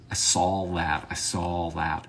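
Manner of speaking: "saw" said like "Saul"; mispronounced "saw". Shown as a demonstration. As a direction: put an L on the end of "saw". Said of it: "pointed out that I mispronounce".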